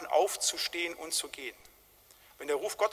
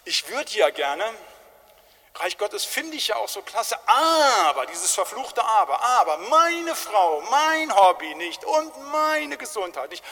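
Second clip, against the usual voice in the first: loud, higher, dragging excuses; whiny excuse-maker; 4 semitones higher, 6 dB louder, slower than usual. Acted. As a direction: spit out the cursed "aber" like a reluctant believer. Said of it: "gesagt, ja".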